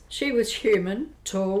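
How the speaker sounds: ordinary talk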